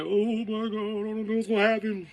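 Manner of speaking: high, anxious rider voice; a scared coaster passenger fretting in a high voice; nervous rider. Retold or imitated. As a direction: play a jittery person on the ride. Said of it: "some be like".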